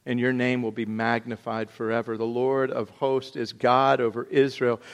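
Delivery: rising into a fervent read prayer; preacher reading a prayer aloud; scripture reading. Acted. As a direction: read the prayer with mounting fervour. Reads David's prayer from scripture.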